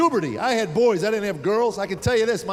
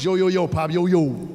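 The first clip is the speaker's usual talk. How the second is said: put-on swagger it like a teen; teen slang greeting; 4 dB louder than usual. Son greeting dad in slang.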